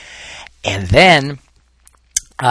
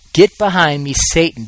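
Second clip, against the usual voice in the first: louder, higher, a stern command; forceful rebuke; 6 semitones higher, 7 dB louder than usual. Retold or imitated. Jesus turning on Peter, loud stern rebuke. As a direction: bark the rebuke like a sharp order.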